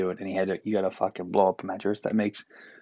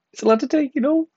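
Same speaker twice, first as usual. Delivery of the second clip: much higher and louder, squeaked mock whine of "It's a lot to take"; squeaky repeat; 6 dB louder than usual. Pasty Newfoundlander joking about summer heat, squeaky high whine. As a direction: squeak the line up high like a whiny kid.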